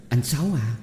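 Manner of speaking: put-on mildly tearful young woman, coaxing; choked with sobs. A narrator's reading. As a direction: plead with a touch of tears. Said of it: "Giọng nó tấm tức tấm tưởi nghẹn ngào". (tấm tức nghẹn ngào)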